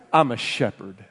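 sudden low, soft delivery; quiet understatement. Acted. Preacher voices David naming his trade, low and understated. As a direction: drop the voice right down, low and understated.

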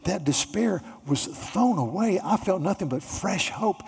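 the healed paralytic, overcome with joy; healed man overjoyed. Acted. Preacher voices the healed paralytic.

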